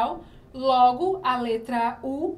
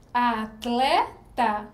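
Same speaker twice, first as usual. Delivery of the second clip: "Atleta" dito bem devagar, sílaba por sílaba; sílabas destacadas; much slower than usual. Shown as a demonstration. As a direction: separe "Atleta" devagar para os alunos ouvirem. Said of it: "identifica aqui o T e o L". ("Atleta" pronunciado devagar, sílaba a sílaba)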